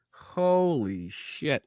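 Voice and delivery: slow, drawn-out Holy shit; stunned disbelief. Real, not acted. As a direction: let it hang in slow disbelief.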